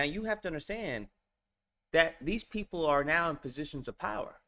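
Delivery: normal talking voice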